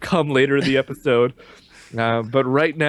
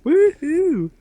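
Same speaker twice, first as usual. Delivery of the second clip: squealed woohoo, much higher; high cheer; 4 dB louder than usual. Real, not acted. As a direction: whoop it out high and gleeful. Gleeful cheer.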